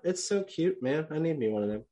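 normal talking voice

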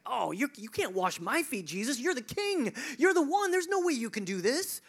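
high, loud pleading protest; protesting Peter. Retold or imitated. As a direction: beg him not to, rising to near a cry. Peter protests, voiced by preacher.

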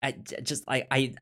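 ordinary delivery

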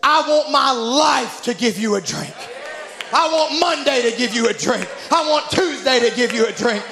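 high, loud, driving sermon delivery; rousing revival preacher in full cry; full-force preaching. Real, not acted. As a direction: preach it with mounting fervor, hammering each phrase.

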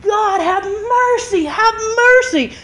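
high, drawn-out cry for mercy; mock desperate scream to God; retold screamed plea. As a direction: wail the prayer slowly, not full volume. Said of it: "I'm in that car screaming".

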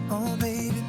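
ordinary talk

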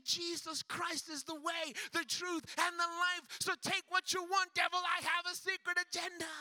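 rising, shouted proclamation to the devil; defiant shout. Retold or imitated. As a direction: build to a loud triumphant declaration.

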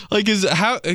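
usual speaking voice

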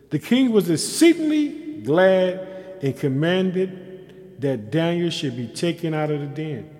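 loud, drawn-out declamation with pauses; preached scripture reading. Real, not acted. Preacher reading of Daniel's rescue, slow loud preaching. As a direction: proclaim the rescue slowly, weighting each phrase. Reads verse at preaching force.

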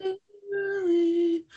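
very high, very slow, spooky drawn-out name; ghostly voice calling. Retold or imitated. Co-host echoes the ghost calling Waverly, high and slow. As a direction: moan the name like a haunting. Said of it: "this ghostly voice calling her name".

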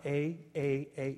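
"a" said as a full "ay", repeated separately; emphatic article. Shown as a demonstration. Preacher ruling out the wrong article, emphatic "a". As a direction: softly set out each "a" as a distinct word. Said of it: "it is emphatic".

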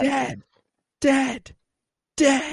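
very high, falling repeated "Dead."; creepy high imitation. Host imitating Agnes's eerie delivery, high and sing-song. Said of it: "Yeah, that".

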